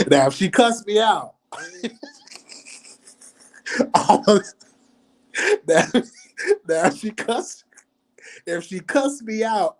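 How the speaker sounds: high-pitched, real laughing speech. Real, not acted. Imagining being cussed out on the call, laughing through it. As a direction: try to talk while cracking up. Cracking up while talking.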